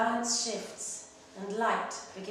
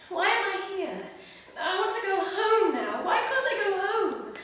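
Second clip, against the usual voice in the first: put-on much higher, louder child's voice with a nervous laugh; homesick little girl; 10 semitones higher, 5 dB louder than usual. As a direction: plead like a lost small child wanting home. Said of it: "On those journeys I'd be thinking".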